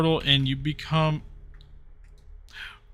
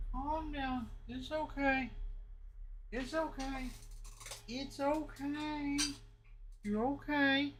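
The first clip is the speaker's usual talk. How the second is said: soothing the dog; 13 semitones higher, 14 dB quieter than usual. Real, not acted. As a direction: hush a scared puppy gently.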